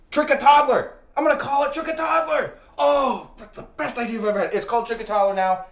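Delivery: high, thrilled shout settling slightly; excited brainwave. Acted. Inventor renaming his candy app, thrilled. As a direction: burst out as if struck by genius. Thrilled at own new name.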